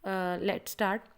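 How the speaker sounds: ordinary delivery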